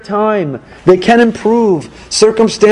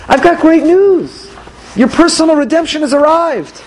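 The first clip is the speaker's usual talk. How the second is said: excited good news; 7 semitones higher than usual. Acted. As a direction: proclaim the great news to them. Acted announcement of great news.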